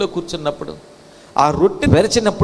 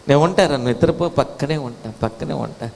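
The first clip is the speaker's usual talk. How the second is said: low voice, sinking softer on the repeats; tender reassurance; 4 semitones lower, 3 dB quieter than usual. Acted. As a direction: soothe a sick child to sleep. Parent soothing a sick child.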